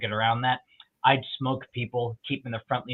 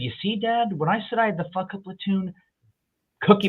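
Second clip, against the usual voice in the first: a touch higher, lightly voiced call to his father; calling to dad; 8 semitones higher than usual. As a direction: shout it up from the parade to dad.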